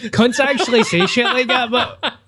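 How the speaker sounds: high, helpless laughing delivery. Real, not acted. Cracking up at his mate's bragger impression, laughing. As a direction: talk through real laughter.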